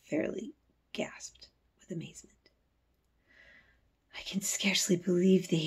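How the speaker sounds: hushed amazed whisper. A narrator's reading. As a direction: breathe it out in wonder.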